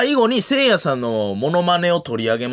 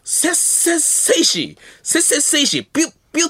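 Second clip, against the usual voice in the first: high-pitched, rhythmic gag chant; Ota; 12 semitones higher than usual. Retold or imitated. Comedian recalls veteran's gag at recording, high mock chant. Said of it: "そこでは太田さん".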